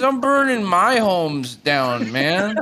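put-on slow, high drunken protest; drunk man. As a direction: drawl it like a confused drunk. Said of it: "He says".

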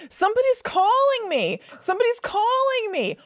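put-on high, singsong sleepy child repeating herself; loopy tired toddler. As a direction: imitate a drowsy kid in a daze. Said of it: "she was all loopy and tired".

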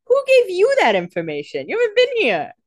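high, loud incredulous questioning; mock-outraged and loud; indignant disbelief. Acting out Damon's startled challenge.

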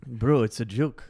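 mock French accent, laughing through it; bad French DJ impression, half laughing; hammed-up French accent, a friend's teasing impression. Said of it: "horrible [name] accent".

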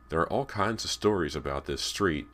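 ordinary delivery, nothing marked

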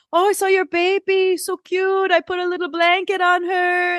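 high, cooing imitation of a Portuguese accent. Housekeeper tells the daughter about the baby doll in a high Portuguese accent. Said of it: "she's doing it with an accent".

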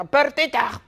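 high, loud call of protest; high-pitched exasperated shout; Jerry shouting. Acted. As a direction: yell the name in exasperation. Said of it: "le grita a Bertita".

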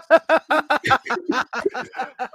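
high, loud burst of real laughter; helpless laughing fit at a friend's joke; big burst of laughter. Real, not acted. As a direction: crack up hard at the joke. Reacting to the Hip Hop Chicken joke.

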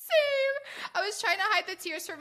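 high, choked-up same; real teary agreement about crying at Elvis; teary admission. Real, not acted. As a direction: say it with a catch of tears in the voice. Admitting she cried at the film.

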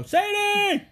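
high, loud call to the next room; yelling a name. Retold or imitated. Dad waking his daughter, calling her name loudly. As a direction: holler her name across the house. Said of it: "I would yell into the other room".

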